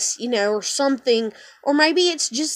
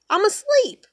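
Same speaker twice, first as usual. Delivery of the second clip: squeaky mock repeat; 9 semitones higher than usual. Repeats asleep in a high squeak.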